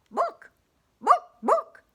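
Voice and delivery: high, loud clucks of book; excited hen clucking. Acted. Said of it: "clucked the hen".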